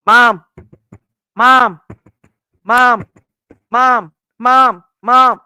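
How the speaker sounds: loud insistent calling of mom; kids calling mom. Retold or imitated. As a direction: call out like kids at the bathroom door. Kids calling through the door.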